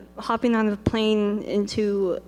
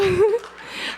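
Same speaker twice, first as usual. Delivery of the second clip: high rising giggle; 10 semitones higher than usual. Real, not acted. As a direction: burst out laughing. Laughing burst.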